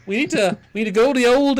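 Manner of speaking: loud, high Canadian caricature; mock Canadian accent. Shown as a demonstration. Co-host demonstrating for Stuart, shouted mock Canadian accent. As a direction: belt it out as a hammed-up Canadian. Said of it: "do a Canadian accent".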